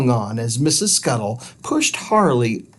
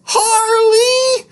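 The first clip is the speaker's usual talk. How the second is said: much higher, slow, loud scolding yell; 4 dB louder, much slower than usual. Acted. Missus Scuttle scolding her dirty dog, loud yell. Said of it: "yelled Missus Scuttle".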